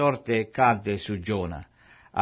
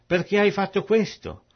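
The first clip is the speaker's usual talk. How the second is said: higher-pitched, alarmed demand; alarmed question; 9 semitones higher than usual. A narrator's reading. Frightened sailors confronting Giona, alarmed. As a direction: read it as a startled, high question. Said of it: "presi da gran spavento e gli dissero".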